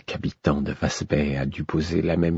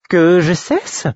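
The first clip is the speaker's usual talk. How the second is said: écho incrédule; 12 semitones higher, 4 dB louder, slower than usual. A narrator's reading. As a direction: question très haut perchée, indignée. Fool echoes the order, revived. (exclamation interrogative aiguë)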